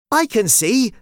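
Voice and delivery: bright, high and eager; excited. Acted. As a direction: burst out with delight. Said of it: "Jada said excitedly".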